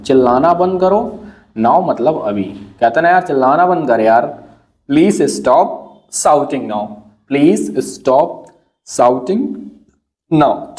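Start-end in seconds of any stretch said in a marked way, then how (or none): 4.9-10.8 slow model read. Shown as a demonstration. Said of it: "teacher models the sentence".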